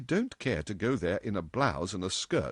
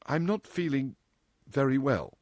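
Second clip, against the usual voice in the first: higher, halting excuse; gulped out; 6 semitones higher than usual. A narrator's reading. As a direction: get the words out awkwardly, in pieces. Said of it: "She gulped out the last words".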